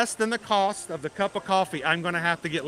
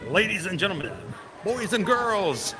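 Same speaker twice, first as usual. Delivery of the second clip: ringmaster; 3 semitones lower than usual. Pretend. Announcer welcome at the park gate.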